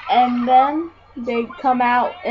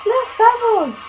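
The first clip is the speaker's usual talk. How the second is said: high, louder excited squeal; pitched-up cartoon excitement; SpongeBob; 12 semitones higher, 5 dB louder than usual. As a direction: cry out in delighted discovery. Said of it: "SpongeBob says to Patrick".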